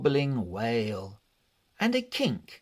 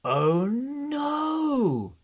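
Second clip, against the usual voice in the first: much higher, squeaky cry of dismay; shocked family; 12 semitones higher than usual. Acted. The woken family finding the cat's mess, high dismayed cry. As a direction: gasp it out like a startled family. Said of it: "they all said".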